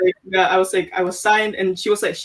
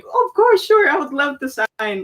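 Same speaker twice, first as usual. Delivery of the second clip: a much higher, eager burst; thrilled acceptance; 7 semitones higher than usual. Retold or imitated. Accepting the record deal from the mentor, eager high delight. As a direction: jump at the offer with delight. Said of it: "I was like".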